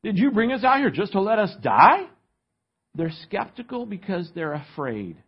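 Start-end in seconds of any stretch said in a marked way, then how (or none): 0.0-2.1 high, loud, incredulous sarcasm; mock-outraged sarcastic questioning. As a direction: challenge the leader with hammed-up disbelief, rising at the end. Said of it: "You can hear the sarcasm in their voice".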